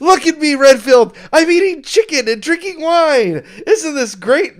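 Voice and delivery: high, loud mock exultation; elated boasting. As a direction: crow about it, hammed-up. Retelling vampire boasting in sunlight.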